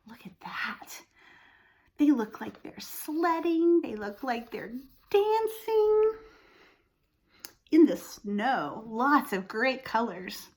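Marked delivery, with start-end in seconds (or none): none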